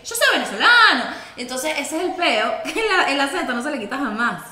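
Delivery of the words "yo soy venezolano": high, louder indignant claim; mock-indignant cry to the cab driver; indignant protest. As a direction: insist hard to the taxi driver. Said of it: "y él y que".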